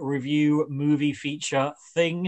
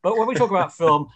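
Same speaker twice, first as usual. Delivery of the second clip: ordinary talk, nothing marked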